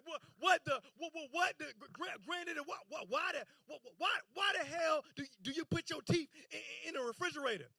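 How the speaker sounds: a lightly voiced shocked stammer; stuttering in shock. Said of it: "I started stuttering".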